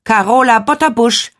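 curt. A narrator's reading. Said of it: "kurz und knapp".

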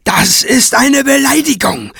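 high-pitched outraged screech. A narrator's reading. Craven protesting Kranz's insult, screeching. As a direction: shriek it in offended indignation. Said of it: "kreischte Craven".

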